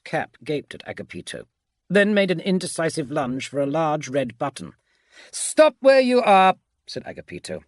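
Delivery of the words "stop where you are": high, loud, drawn-out order; commanding shout. A narrator's reading. Agapito halts a man lunging for a button, commanding shout. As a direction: call out a firm halt.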